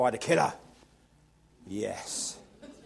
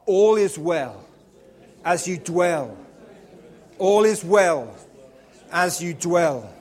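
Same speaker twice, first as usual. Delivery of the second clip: proclaimed slogan; 2 semitones higher, 8 dB louder, slower than usual. Shown as a demonstration. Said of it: "Turn to the person next to you and say".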